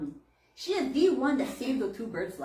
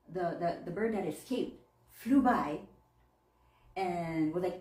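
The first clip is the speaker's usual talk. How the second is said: normal talking voice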